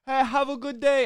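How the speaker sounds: high, loud and cheery greeting; greeter voice. Retold or imitated. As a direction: chirp a bright hello. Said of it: "it was like".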